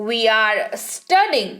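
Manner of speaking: very slow, careful model sentence; very slow dictation. Shown as a demonstration. Teacher dictating to the class, very slow. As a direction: drag each word out for learners. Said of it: "teacher models English sentence".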